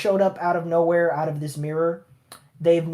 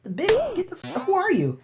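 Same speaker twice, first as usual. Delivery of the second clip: much higher, squeaky indignant voice; shrill mock outrage; 3 dB quieter than usual. Retelling the anime, mother confronts intruding villain, high mock squeal. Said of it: "And she's like".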